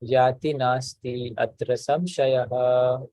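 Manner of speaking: slow, careful Sanskrit chant; slow recitation. Shown as a demonstration. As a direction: recite each word slowly and clearly. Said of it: "one sentence at a time".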